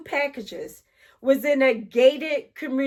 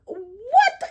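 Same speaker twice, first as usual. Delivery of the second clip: startled; 9 semitones higher than usual. Retold or imitated. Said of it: "And I'm like".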